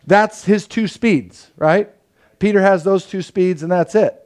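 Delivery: usual speaking voice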